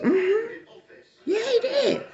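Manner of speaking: high laughing yes. Real, not acted. Telling the crowd what he bought, high laughing.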